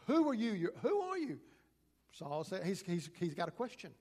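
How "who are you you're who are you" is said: a sudden loud call, much higher; loud cry. Acted. Saul calling out loud to the voice from the ground. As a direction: cry out the question, pitch jumping up.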